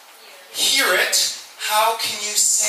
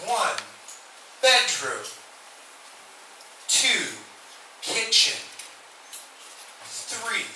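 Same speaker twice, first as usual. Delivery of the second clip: slow drill demo; 5 dB quieter, slower than usual. Shown as a demonstration. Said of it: "Why is it slow?".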